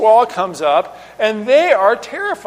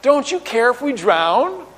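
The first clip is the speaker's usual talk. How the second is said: drawn-out sarcastic question, pitched up; slow, pointed sarcasm with a rising end; 4 semitones higher than usual. Acted. Preacher repeats the disciples' question to show sarcasm. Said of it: "you note the sarcasm in there".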